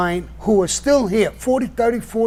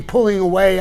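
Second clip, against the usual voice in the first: usual speaking voice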